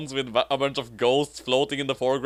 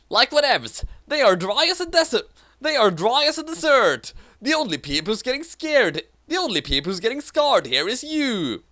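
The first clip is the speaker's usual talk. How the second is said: put-on valley girl; 10 semitones higher than usual. Valley-girl character reply.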